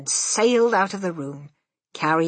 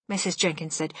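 ordinary delivery

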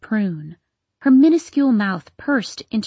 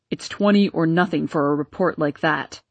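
barking; 4 semitones lower than usual. Acted. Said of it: "barked Papa".

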